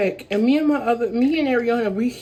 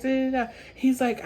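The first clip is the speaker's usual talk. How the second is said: ordinary talk, nothing marked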